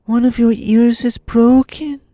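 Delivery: high-pitched Tip, drawn out; high slow boy. A narrator's reading. Tip answering the puzzled horse, slow high voice.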